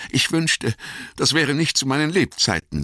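very high, plaintive hobbit voice; troubled hobbit. Acted. Frodo wishing these times away to Gandalf, high and plaintive. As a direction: lift the voice right up, wishing it away.

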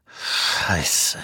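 groaned curse under the breath; breathy, pained groan. Acted. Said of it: "stöhnte Stone".

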